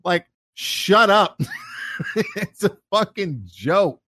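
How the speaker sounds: high, slow, laughing retort; mock exasperation. Buddy dismissing the spoilsport at his joke, laughing exasperation. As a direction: stretch it out, comically fed up. Acting out annoyance at offended partner.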